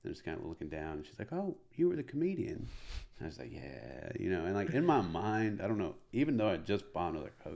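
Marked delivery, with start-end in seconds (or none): 1.3-2.7 high-pitched imitation of an old lady. Old lady recognises the comic outside, high imitated voice. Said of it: "this old lady". 3.5-4.1 low, soft admission; sheepish yeah. Retold or imitated. Comic admits it to old lady, sheepish.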